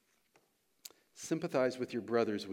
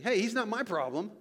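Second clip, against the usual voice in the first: higher-pitched, dismissive brush-off; Cain; 6 semitones higher than usual. Acted. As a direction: shrug off all responsibility. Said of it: "Cain was saying".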